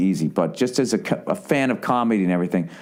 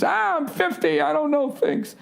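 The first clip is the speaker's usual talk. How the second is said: high, whiny lightly voiced old man; hammed-up excuse for not knowing; mock old excuse.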